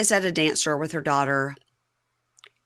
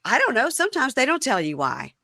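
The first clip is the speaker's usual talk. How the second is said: higher-pitched imitated excuse; defensive excuse; 8 semitones higher than usual. Host mimics prisoner dodging girlfriend's question, defensive high voice. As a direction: dodge the question, mock-defensive.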